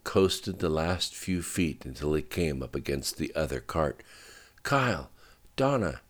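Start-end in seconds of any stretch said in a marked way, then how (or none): 4.6-6.0 high-pitched shouted names; mild shout, pitch raised; calling out. A narrator's reading. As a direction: call out for missing friends. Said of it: "he shouted into the echoing tunnel".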